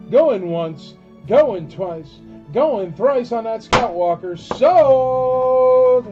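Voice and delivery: building auctioneer call, ending in a shout; going once, twice, sold, rising to a bellow. Acted.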